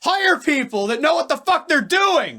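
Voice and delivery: sustained high, loud screaming rant; scary yell. Real, not acted. Fan venting at team ownership until co-host is scared, full yell. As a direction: scream the whole demand at full voice. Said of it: "[name], you're scaring me".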